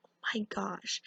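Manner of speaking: an urgent, breathless whisper. Retold or imitated. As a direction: hiss it so they won't hear. Sister freaks out, whispering.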